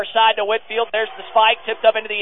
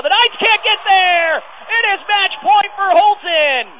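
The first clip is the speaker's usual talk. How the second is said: higher shouted match point call; excited shout; 8 semitones higher than usual. Real, not acted. Announcer shouting match point to listeners, excited.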